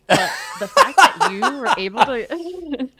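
loud, high laugh breaking the words. Real, not acted. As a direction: laugh out loud mid-sentence. Host laughing at the story.

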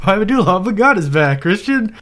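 high, loud, hammed-up glee; over-the-top squealed mock announcement; mock exclamation. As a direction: proclaim it like ridiculous big news.

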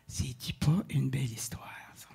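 whispered to the audience; whispered aside. Real, not acted. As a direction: murmur it, touched. Touched aside after the story.

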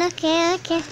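ordinary delivery

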